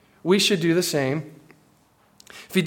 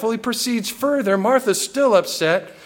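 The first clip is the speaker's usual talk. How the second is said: ordinary talk, nothing marked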